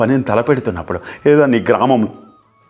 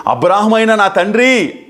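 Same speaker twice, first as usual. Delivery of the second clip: acted cry of the rich man; 6 semitones higher, slower than usual. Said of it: "అని అక్కడ కేకలు వేస్తున్నాడు".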